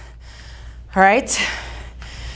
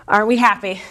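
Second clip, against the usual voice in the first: ordinary talk, nothing marked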